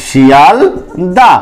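শিয়ালদা drawn out very slowly, the final হ dropped; pronunciation demo. Shown as a demonstration. Teacher models শিয়ালদা for students, very slowly, without the হ. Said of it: "আমরা উচ্চারণ করি হচ্ছে".

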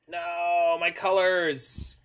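loud, drawn-out mock wail; playful dismay. Real, not acted. Dismay at his mana colors.

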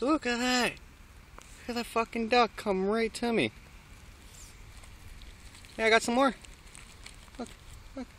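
high, sing-song pet voice; cooing at the duck. Real, not acted. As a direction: coo at the duck like it's a pet.

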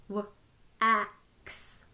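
each sound said separately with a short break; sounds tapped apart. Shown as a demonstration. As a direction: split the word into its sounds, one by one. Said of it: "Let's tap it again".

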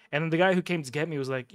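ordinary talk

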